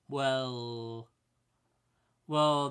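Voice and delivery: normal talking voice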